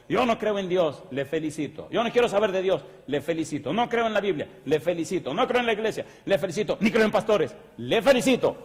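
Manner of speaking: loud, high mock objections each answered; preacher running through excuses; emphatic preaching.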